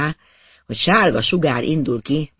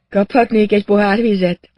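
higher voice, lightly raised question; patient's polite request; 7 semitones higher, 4 dB louder than usual. Acted. Revived patient asks inspector for water, higher-pitched. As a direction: ask for it in a lifted patient's voice.

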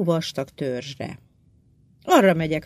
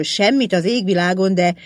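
ordinary delivery, nothing marked

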